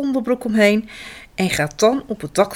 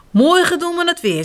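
bright higher voice for papa; cheerful papa; 9 semitones higher, 3 dB louder than usual. A narrator's reading. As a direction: sound upbeat and lively. Papa Wapper voiced in story. (vrolijke papa)

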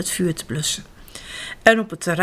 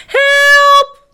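very high, loud desperate scream; cry for help; 10 dB louder than usual. A narrator's reading. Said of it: "roept een vrouw die voor". (wanhopig om hulp schreeuwen)

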